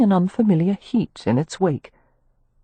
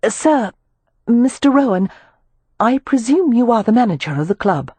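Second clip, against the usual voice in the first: put-on raised, polite young lady; Amelia's voice; 7 semitones higher, 3 dB louder than usual. Amelia addressing the club manager, formal and raised. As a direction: speak up, formally courteous.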